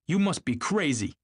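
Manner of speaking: high-pitched exclamation of disbelief; exclaiming. A narrator's reading. Tom rejecting Gatsby's claim, exclaiming. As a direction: burst out, pitch leaping up. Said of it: "exclaimed Tom automatically".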